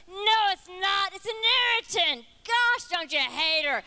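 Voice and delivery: high, loud, scornful devil. Acted. Devil on her shoulder mocking the Holy Spirit, loud and high. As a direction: jeer it like a nasty little devil. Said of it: "you got the devil on the other side".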